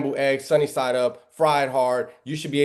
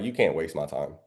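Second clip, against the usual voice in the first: low firm line; 6 semitones lower, 5 dB quieter than usual. Shown as a demonstration. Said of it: "Me, I say it a little bit different".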